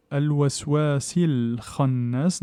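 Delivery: al-waswasil khannas drawn out in Quranic recitation; slow tajwid recitation. Shown as a demonstration. Teacher slowly demonstrating al-waswasil khannas to students. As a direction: chant al-waswasil khannas slowly, gliding from sin to lem. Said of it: "je passe du sin vers le lem".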